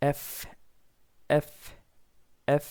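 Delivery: এফ repeated, the final "f" voiced with the lips and teeth; এফ stressed, a crisp "ef"; correct letter F. Shown as a demonstration. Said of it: "এটার উচ্চারণ হবে".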